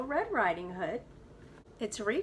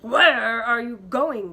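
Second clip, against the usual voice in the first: louder, slow wolf growl; 2 semitones higher, 12 dB louder, slower than usual. Acted. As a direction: growl the question like a big bad wolf. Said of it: "growled the wolf".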